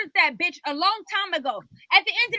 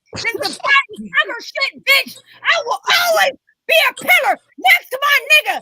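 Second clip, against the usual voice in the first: real angry shout, raised high and loud; furious shout; 5 semitones higher, 6 dB louder than usual. Real, not acted. Panelist rants at a rival on the show, furious shout. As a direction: shout it as a defiant declaration.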